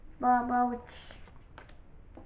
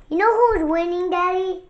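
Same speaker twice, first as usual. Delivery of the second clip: excited; 5 semitones higher, 7 dB louder than usual. Real, not acted. Excitedly asks dad.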